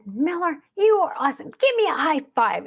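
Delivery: put-on high-pitched cheering to a toddler; retold excited praise.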